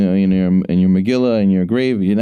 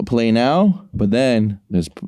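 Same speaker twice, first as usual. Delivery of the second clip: ordinary talk, nothing marked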